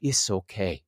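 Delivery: low, soft imitated comforting; soothing reassurance. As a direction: drop low and quiet, as if patting someone's back. She pats him, reassuring.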